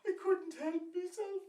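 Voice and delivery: very high, loud comic wail; hammed-up crying. Woman confessing to the priest about the goose, mock sobbing. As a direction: wail it tearfully, far above your own pitch. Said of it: "she starts crying, and she's getting very sad".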